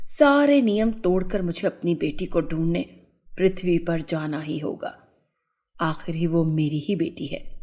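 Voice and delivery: low mother's voice, determined; resolute mother fairy. A narrator's reading. Mother resolves to find her daughter, low determined voice. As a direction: drop well down as the resolved mother.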